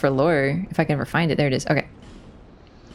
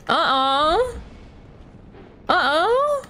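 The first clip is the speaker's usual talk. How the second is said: mock alarm; 8 semitones higher, much slower than usual. Enemy approaching, rising alarm.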